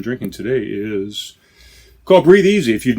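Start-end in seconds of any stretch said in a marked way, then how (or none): 2.0-2.7 excited announcement. Acted. Announcing the beer's name.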